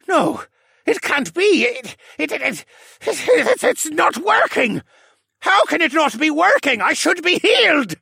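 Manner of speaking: high, stammering panic building to loud outrage; stammer, then shout in desperate denial; frantic disbelief. A narrator's reading. Villain realises the cure is failing, frantic and broken. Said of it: "frightened and broken man".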